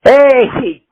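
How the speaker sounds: usual speaking voice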